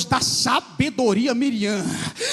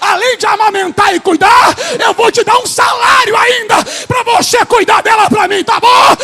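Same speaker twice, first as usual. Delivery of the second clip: higher, louder climactic preaching; preaching at full force; 6 semitones higher, 8 dB louder than usual. Acted. Preacher crying out the queen's offer at full force. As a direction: build to a triumphant peak.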